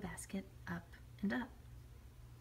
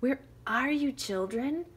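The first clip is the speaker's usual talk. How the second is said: loud, higher calling shout; wolf shouting; 7 semitones higher, 9 dB louder than usual. Acted. Wolf calling up the tree, shouting. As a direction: bellow for the children. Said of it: "At last the wolf shouted".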